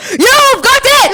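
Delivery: high screaming shout; jump scare shout. Acted. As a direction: leap at them with a piercing yell. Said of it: "raised its arms, and said".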